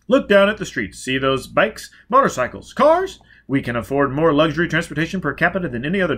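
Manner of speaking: loud, high, pushy sales pitch; booming salesman mayor. Acted. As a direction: sell the town like a carnival barker.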